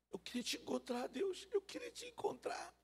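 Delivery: high, soft mock reverence; ironic awe. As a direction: pretend to meet God in person. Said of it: "Eu fui irônico com ela".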